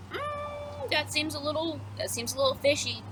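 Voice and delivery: mock suspicion in a small, high voice; suspicious. Chorus doubts the guard's story, mock suspicious.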